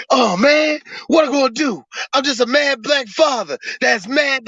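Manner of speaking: high, wailing mock despair; mock whining father. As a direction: whine it like a hammed-up movie dad. Mocking the movie's distraught father.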